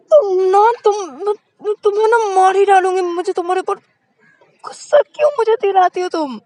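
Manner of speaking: high, loud, fast angry scolding; furious girlfriend. A narrator's reading. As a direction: threaten her in a rage. (गुस्से में चीखती हुई ऊँची आवाज़)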